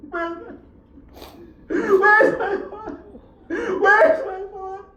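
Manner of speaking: high tearful plea with a catch in the voice; a preacher voicing the orphan girl's sobbing plea; weeping child. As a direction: cry it out like a lost little girl. Girl weeping for her father.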